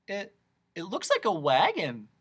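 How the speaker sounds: put-on mildly excited boy, pitch lifting; a touch of eager excitement. A narrator's reading. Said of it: "cried Milo excitedly".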